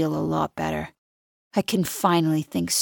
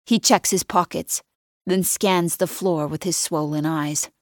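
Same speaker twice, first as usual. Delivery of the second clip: usual speaking voice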